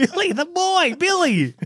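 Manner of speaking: high, laughing mock of the phrase; high mocking echo. To the co-hosts, echoing the customer high and amused.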